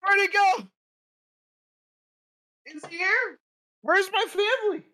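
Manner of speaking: very high squeaky panicked critter voice; armadillo impression. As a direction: squeal like a lost little armadillo. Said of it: "could you give me an impression of an armadillo".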